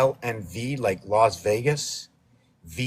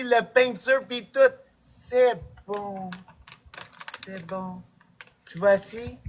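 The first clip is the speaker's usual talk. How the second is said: higher, put-on Québécois chatter; French-Canadian character voice; 7 semitones higher than usual. Playing a Québécois offering the dog biscuits, higher put-on voice.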